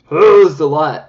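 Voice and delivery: loud, high surprised oh; surprised shout. Real, not acted. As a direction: caught off guard by the blob. Too much product from doe foot.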